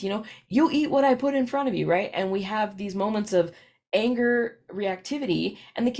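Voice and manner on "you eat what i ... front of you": quick, higher mock scolding; laying down the law at dinner; frustrated parent. Parent ordering a child at the table, quick mock scold.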